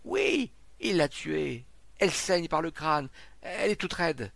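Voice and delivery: high, loud, horrified announcement; alarmed report. A narrator's reading. Witness answering the disbelieving crowd, alarmed shout. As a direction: shout the news in shock.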